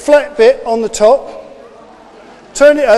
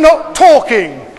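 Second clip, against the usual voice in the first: stern call to order; 5 dB louder than usual. Real, not acted. Teacher calling class to order.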